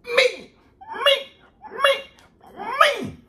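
high-pitched, loud squeals of self-praise; exultant shout. Acted. Boastfully answers his own question.

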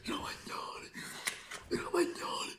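breathy whispered chant of the name. Acted. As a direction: hiss the name in a hushed thrill. Goal call, whispered take.

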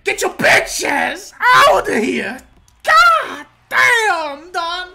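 high, full-volume victory yell; drawn-out roar of hype; triumphant shout. Real, not acted. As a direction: scream it slowly at the beaten opponent. Knocks out the opponent.

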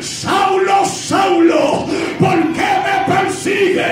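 a high, called-out heavenly voice crying his name; voice of Jesus. Acted. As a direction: call down to Saulo as the voice from heaven. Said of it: "esta voz que le dice". (la voz de Jesús llamando a Saulo, en alto)

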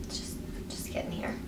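breathy hushed whisper; whispering to herself while applying. Real, not acted. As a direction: murmur to the makeup as you tap it on. Dabs highlighter, hushed.

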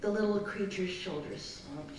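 plain reading voice

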